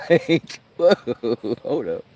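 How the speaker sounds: high, loud laughing double take; stunned. Real, not acted. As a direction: stop them mid-sentence in shock. Retelling shock, laughing.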